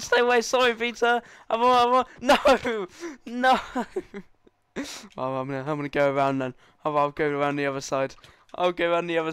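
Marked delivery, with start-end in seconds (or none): 0.0-3.6 high loud pleading no; panicked shout. Real, not acted. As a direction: fend off an attacker, half laughing. Being attacked in game.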